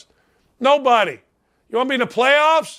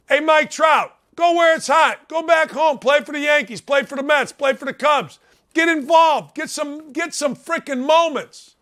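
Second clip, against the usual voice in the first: higher-pitched, urgent exhortation in punchy commands; exasperated calling-out; 4 semitones higher than usual. Real, not acted. Calling out to an absent player.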